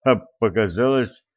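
ordinary delivery, nothing marked

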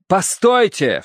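high-pitched cry to stop. A narrator's reading. Narrator jumps from the carriage, crying out. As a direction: cry out high to halt them. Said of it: "вскричал я".

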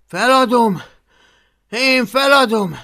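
high, loud despairing cry; shouting over the storm; shouted. Acted. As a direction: yell it into the wind. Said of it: "kiáltotta vissza Hablaty".

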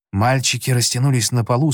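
plain reading voice

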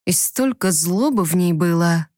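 ordinary delivery

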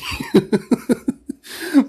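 high unvoiced giggle; high breathy laugh. Real, not acted. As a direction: burst of airy laughter. Laugh inside mock prayer.

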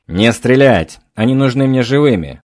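loud yell from above. A narrator's reading. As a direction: bellow the order out a window. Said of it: "заорали из того окна".

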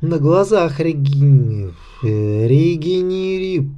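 slow, tripping over the word; drunken slur. A narrator's reading.

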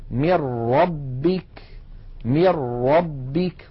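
من ربك recited slowly as "mir-rabbik", n swallowed by a doubled r; merging into ra. Shown as a demonstration. Teacher demonstrating the ra example, doubled r in من ربك. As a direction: go straight to a strong r in من ربك. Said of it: "مثال الراء".

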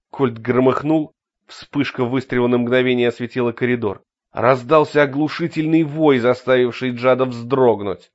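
plain reading voice

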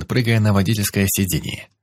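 plain reading voice

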